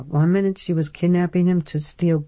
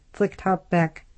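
usual speaking voice

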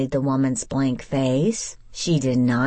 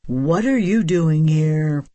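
surly man, louder and confronting; surly demand; 5 dB louder than usual. A narrator's reading. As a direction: demand it like a grumpy householder. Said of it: "a surly man demanded".

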